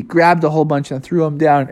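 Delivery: usual speaking voice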